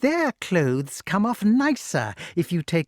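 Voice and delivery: high-pitched, swooping witch voice; gloating witch. A narrator's reading. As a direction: explain the cruelty with mock reassurance. Voices Madam Mim gloating.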